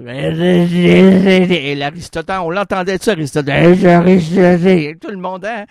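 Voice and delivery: proclaiming the great philosophers, loud and slow; booming proclamation. Acted. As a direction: boom each name across the room like an orator.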